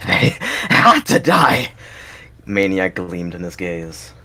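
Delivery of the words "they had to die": high, loud, slow insistence; slow, high manic yell; manic shout. Acted. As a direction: spit each word out, unhinged. Villain insists, mania in gaze.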